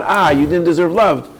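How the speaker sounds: ordinary talk, nothing marked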